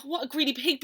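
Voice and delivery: usual speaking voice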